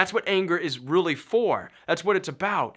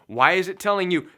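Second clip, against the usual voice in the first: usual speaking voice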